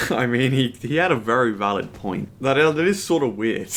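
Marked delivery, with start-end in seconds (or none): none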